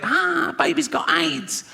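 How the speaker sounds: high, slow, drawn-out mock wail; mock wailing lament. Comic grieving his own punchline, slow high wail. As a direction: wail it like a hammed-up grieving mother. The joke replayed on Netflix.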